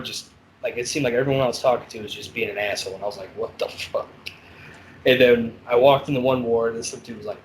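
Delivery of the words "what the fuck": muttered. Retold or imitated. Bad day, fed up inmates.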